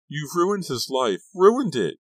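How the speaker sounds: very high, loud accusation; screaming. A narrator's reading. Girlfriend screaming at Kerry again, furious. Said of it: "Sharon was screaming at him again".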